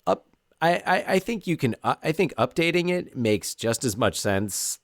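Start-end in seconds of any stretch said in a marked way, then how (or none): none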